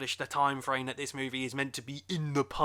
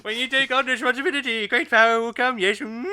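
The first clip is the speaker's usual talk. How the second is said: much higher, much louder, odd voice; William Hartnell; 8 semitones higher, 9 dB louder than usual. Retold or imitated. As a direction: boom it like an old Doctor. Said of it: "like William Hartnell".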